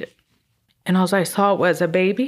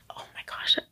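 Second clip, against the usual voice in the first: awed whisper; 9 dB quieter than usual. Real, not acted. Overcome at seeing the baby.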